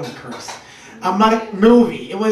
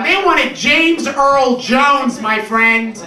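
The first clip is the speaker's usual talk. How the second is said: ordinary talk, nothing marked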